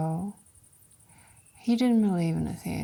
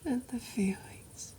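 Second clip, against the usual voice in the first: distant whisper; 4 semitones higher, 12 dB quieter than usual. Acted. Actress recalling past feelings to Kostya, faint whisper. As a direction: let it drift away as memory. Far unvoiced fading.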